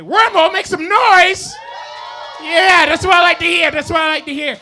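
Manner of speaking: high, loud rallying shout; hyping the crowd. Real, not acted. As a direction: whip the audience into cheering. Hypes the crowd for noise.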